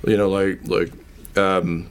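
usual speaking voice

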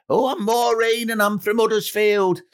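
mock northern contestant. Contestant voiced in TV anecdote.

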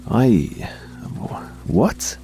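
shocked, high stammering what; frightened stammer. Acted. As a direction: stutter in fear, pitch jumping up. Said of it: "I stammered".

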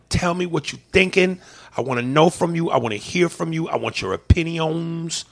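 normal talking voice

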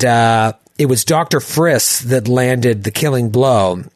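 ordinary talk

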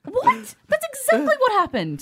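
high, indignant what; mock outrage. Real, not acted. Denying being called a liar.

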